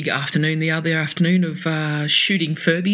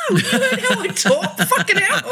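high, laughing brush-off; laughing retort. Retold or imitated. Telling a mate it's a joke, high and laughing. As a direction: laugh it off at her gullibility. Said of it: "I went".

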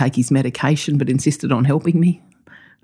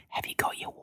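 imitating dad in a sly, hushed whisper; really quiet; 16 dB quieter, faster than usual. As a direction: barely whisper the question, as a tease. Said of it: "Really quietly".

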